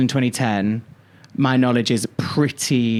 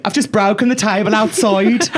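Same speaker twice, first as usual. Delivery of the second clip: put-on higher, louder Brummy accent with a laugh; 7 semitones higher, 3 dB louder than usual. Host imitating Alison confessing the broken table, Brummy accent. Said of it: "I'm trying to do Brummy accent".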